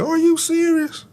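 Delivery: a teacher who can't believe her ears; high astonished question; surprised teacher. Retold or imitated. Teacher stunned by offer.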